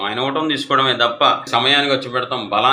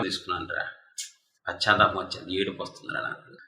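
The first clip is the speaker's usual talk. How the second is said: grieving whisper; 5 semitones lower, 10 dB quieter than usual. Acted.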